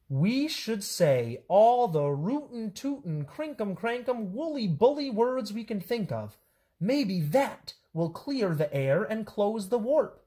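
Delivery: high, lively storybook voices with swooping pitch; bouncy picture-book read-aloud; animated children's story reading. A narrator's reading. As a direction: read it to kids, playing up every silly word.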